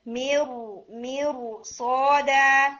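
مرصادا with a full, heavy ra; teacher modelling heavy ra; heavy ra demo. Shown as a demonstration. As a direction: recite مرصادا with a thick ra. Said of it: "را کو heavy کر کے پڑھیں گے".

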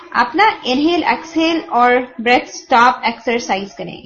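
ordinary talk

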